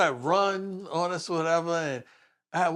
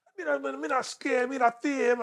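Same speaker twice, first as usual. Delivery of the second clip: high imitated Jamaican voice; Jamaican accent; 9 semitones higher than usual. Rider talking back to the officers, high mock Jamaican accent. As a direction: mimic the Jamaican rider, pitched well up. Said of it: "he's a Jamaican guy".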